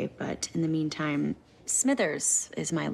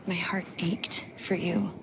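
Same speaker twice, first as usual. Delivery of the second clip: tender whisper. Acted. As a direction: let it ache, slow and hushed. Love confession.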